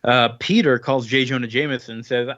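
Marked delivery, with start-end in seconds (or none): none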